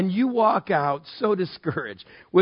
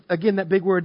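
normal talking voice

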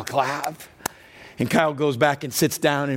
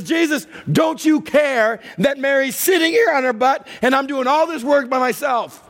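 frantic hostess snapping at the guest of honor; angry complaint; 10 semitones higher than usual. Acted. Angry woman yelling at Jesus.

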